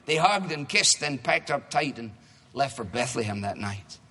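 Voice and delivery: rhymed storybook reading to a congregation; reading a story. Acted. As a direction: read it like a picture book for kids.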